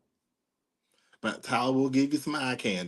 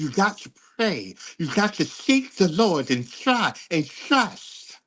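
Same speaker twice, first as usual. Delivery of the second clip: higher, slow preachy churchy drawl; Deborah's mother character, higher and slow; imitating the mother; 7 semitones higher, slower than usual. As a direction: do the pious mother urging prayer. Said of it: "it sounds like this".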